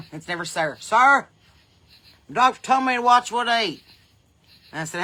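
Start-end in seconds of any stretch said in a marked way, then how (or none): 0.8-3.8 lightly voiced old farmer, higher and louder; old man voice. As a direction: deliver it like the old man at the feed store. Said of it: "And he said".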